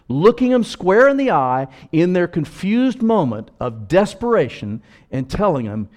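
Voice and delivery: loud, high preacher's climax; full-force preaching. Acted.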